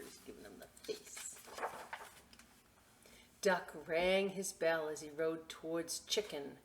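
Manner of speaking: plain reading voice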